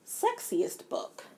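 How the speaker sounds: slow, breathy whisper of the title; sultry whisper. Acted. Announcing the sexiest book category in a slow, sultry whisper. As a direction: whisper it slowly, teasing.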